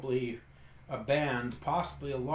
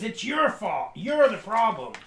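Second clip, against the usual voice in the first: higher, mildly heated blame; acted accusation; 8 semitones higher, 6 dB louder than usual. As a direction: throw the blame back as a farmer.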